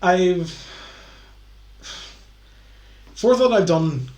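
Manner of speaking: tipsy, fumbling over numbers. Real, not acted. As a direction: slur a little, lose count. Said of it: "I'm very drunk right now".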